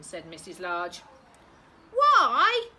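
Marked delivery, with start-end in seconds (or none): 1.9-2.7 put-on squeaky, loud child's why; high-pitched children asking. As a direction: pipe up like small kids. Two children ask in unison.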